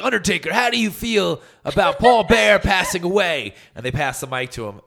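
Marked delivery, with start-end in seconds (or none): 0.0-3.5 mock backstage interviewer, higher and louder; a hammed-up reporter shoving a mic. Interviewer asking Undertaker about Paul Bear, mock reporter voice. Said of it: "They're like".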